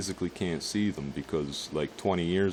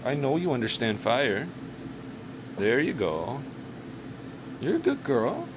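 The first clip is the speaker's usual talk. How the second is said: higher pet-talk voice; talking to the dog; 5 semitones higher than usual. Real, not acted. Talking to his dog while smoking, high pet voice. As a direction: coax the dog while lighting up.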